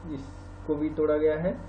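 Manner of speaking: ordinary talk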